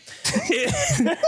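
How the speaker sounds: high burst of laughter; helpless high-pitched laugh; real laugh. Real, not acted. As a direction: crack up mid-performance. Cracks up at own accent bit.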